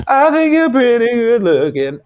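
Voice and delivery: high, loud, slow flirty line; mock flirty voice. Teasing his co-host through a pretend Twitch whisper, in a high mock flirty voice. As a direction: ham up a flirt.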